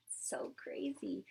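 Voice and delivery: whispered in awe, slow; awed whisper. Real, not acted. Reader awed by the fish feeder, whispering. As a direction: breathe it out in wonder. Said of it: "amazed at feeder".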